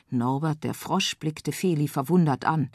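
ordinary delivery, nothing marked

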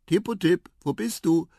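high searching call, not truly soft; softly calling. A narrator's reading. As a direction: call out for a lost friend. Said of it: "fragte er dann leise". (mit hoher Stimme suchend rufen)

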